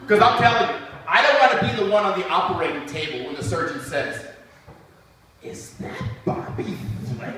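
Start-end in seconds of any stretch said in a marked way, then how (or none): 5.4-7.3 soft, amazed whisper; surgeon's hushed whisper. Retold or imitated. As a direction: whisper it in disbelief.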